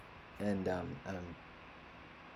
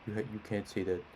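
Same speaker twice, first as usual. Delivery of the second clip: lightly voiced dad talking down; a hint of babying parent; imitating dad. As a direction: mildly mimic dad scolding a child. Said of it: "he still talks like this".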